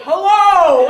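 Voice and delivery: loud, hearty greeting; loud man's hello. Retold or imitated. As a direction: boom it out. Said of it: "Lene turned around and said".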